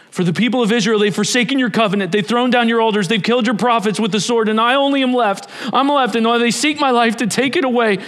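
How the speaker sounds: high, rapid, pressing protest; agitated complaint. Acted. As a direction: pour out the grievance urgently. Elijah's agitated complaint to God.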